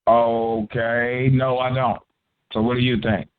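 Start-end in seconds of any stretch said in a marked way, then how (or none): none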